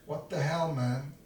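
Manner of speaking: ordinary talk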